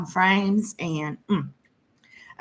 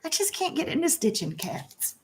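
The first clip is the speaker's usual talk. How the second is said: 1 high soft aside; 10 semitones higher, 7 dB quieter than usual. Real, not acted. Aside about cats.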